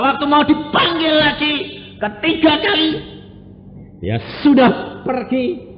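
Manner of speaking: high, loud preacher's cry of thanks; fiery preaching. Acted. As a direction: preach it out at the top of the voice. Preaching at full force. (khotbah berapi-api dengan suara tinggi dan keras)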